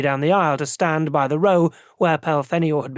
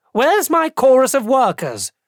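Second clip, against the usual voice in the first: loud, higher call from the floor; shouting across the hall; 9 semitones higher, 5 dB louder than usual. Acted. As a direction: shout it to the whole auditorium. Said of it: "shouted from the floor".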